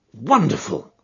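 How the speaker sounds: bright burst of wonder at his deduction; amazed exclamation. A narrator's reading. Watson marvelling at Holmes' deduction, mild excited cry. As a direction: gasp it out, impressed. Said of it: "I ejaculated".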